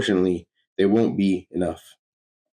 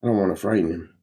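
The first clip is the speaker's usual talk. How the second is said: plain reading voice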